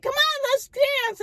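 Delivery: high, eager coaxing; friends cheering. Retold or imitated. Friends pulling her up to dance, high and eager. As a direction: playfully urge her onto the floor. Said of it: "my friends are like".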